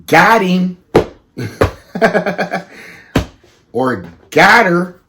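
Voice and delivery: real laugh through "Got him", high and loud; laughing, then slow on "Or got her". Real, not acted. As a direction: crack up at your own joke. Laughing at own punchline.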